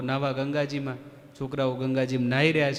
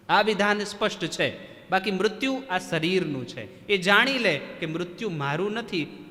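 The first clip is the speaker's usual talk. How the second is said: emphatic preaching; 3 semitones higher, 4 dB louder than usual. Acted. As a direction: press the truth home to the listeners. Preaching on inevitability of death. (ઉપદેશકનો ભારપૂર્વક, ઊંચો અવાજ)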